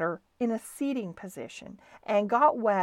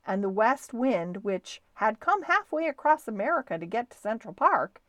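plain reading voice

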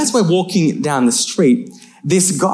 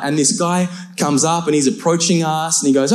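normal talking voice

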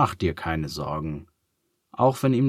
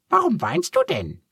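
very high, squeaky little duck, curious; a cheerful duck asking kindly; 3 dB louder than usual. A narrator's reading. Duck Hottentotte speaks.

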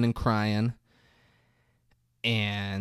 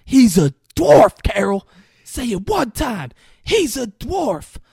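retold screaming; 11 semitones higher, 11 dB louder than usual. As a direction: act out screaming at a partner. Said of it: "screaming this at her".

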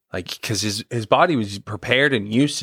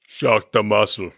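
bad Austrian accent impression; slow mock Austrian accent, breaking into laughter; hammed-up Austrian action-star accent; Schwarzenegger; slower than usual. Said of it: "you gotta say it in the voice".

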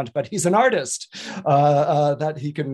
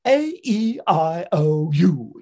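slow vowel drill; 5 dB louder, much slower than usual. Shown as a demonstration.

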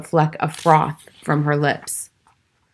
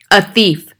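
loud, higher-pitched yell; screamed accusation; 4 semitones higher, 8 dB louder than usual. A narrator's reading. Headmistress accuses the boy before the school, screaming. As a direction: yell it as a damning charge. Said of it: "the Bogtrotter screamed".

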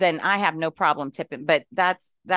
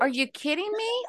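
incredulous; 12 semitones higher, 4 dB quieter than usual. Real, not acted. Ranting about phone chat support.

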